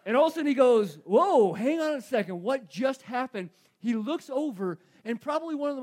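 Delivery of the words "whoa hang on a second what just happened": loud, higher mock astonishment; big retold "hold on" moment, slowing at the end; acted startled realization. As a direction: blurt it out as if suddenly caught off guard. Acts out Peter's sudden realization.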